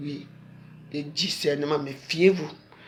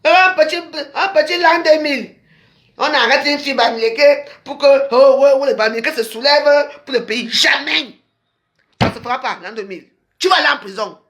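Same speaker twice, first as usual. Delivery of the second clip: raised, higher, accusing tirade; heated rant; 6 semitones higher, 4 dB louder than usual. Real, not acted. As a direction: hammer the accusations home, louder. Denounces rival, raised voice. (diatribe enflammée contre l'adversaire)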